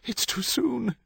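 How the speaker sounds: very high, despairing wail. A narrator's reading. Wife in premature labour, wailing in dismay. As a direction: wail it, drawing out the repeat. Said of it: "she wailed".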